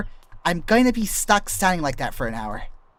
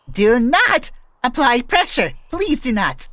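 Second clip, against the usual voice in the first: much higher, louder and slower, throat tightening; higher pitched; 9 semitones higher, 6 dB louder, slower than usual. Shown as a demonstration. Warning chat off throat pressure, voice squeezing up high. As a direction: let the voice climb up as the throat constricts. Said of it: "you see that little bit of higher pitch I did there".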